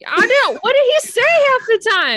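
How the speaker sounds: exasperated. Real, not acted. Mocking the mumbling character.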